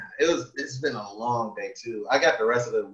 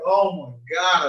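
after laughing hard; 9 semitones higher, 10 dB louder, slower than usual. Real, not acted. After laughing at sword story.

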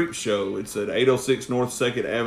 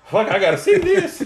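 laughing excitement; 5 dB louder than usual. Retold or imitated. Eager to see drunk friend.

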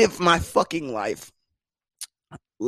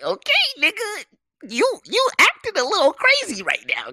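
high, mocking woman's voice; a put-on high girl voice brushing him off; imitating her. Woman brushing off an awkward guy, high mocking voice.

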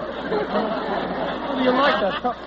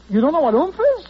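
incredulous; 8 semitones higher, 6 dB louder, faster than usual. Acted. Incredulous at Gene.

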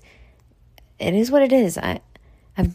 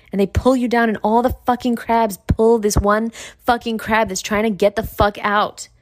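ordinary talk

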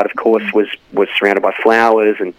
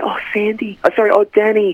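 [name]; 9 semitones higher than usual. Retold or imitated. Guest mimics the Grease heroine for the host, high-voiced role. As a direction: lightly imitate a film heroine, pitched up. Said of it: "for her to go up there and say".

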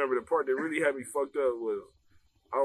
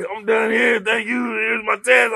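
loud, higher exit announcement; hurried shout; 9 semitones higher, 11 dB louder, slower than usual. Retold or imitated. Student handing in his test early, loud and rushed. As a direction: call it out as you bolt. Rushing out of exam.

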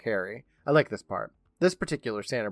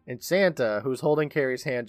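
usual speaking voice

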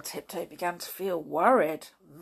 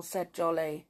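usual speaking voice

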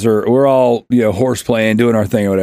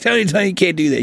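lightly voiced scolding teacher, higher; imitating teachers; 6 semitones higher, faster than usual. Teachers stopping Tony in the hallway, mock scolding. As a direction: imitate a teacher's hurried telling-off. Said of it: "the teachers are like".

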